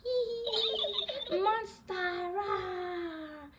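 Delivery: high giggle then a monster rah; little monster. Acted. George in a monster costume, giggly and high. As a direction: play a giggling toy monster. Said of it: "shouted George".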